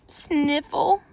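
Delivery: plain reading voice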